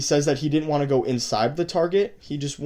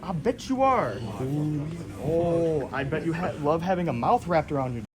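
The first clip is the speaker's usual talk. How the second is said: taunting; 3 semitones higher than usual. Acted. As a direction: taunt him with his own lines. Taunting predator with his words.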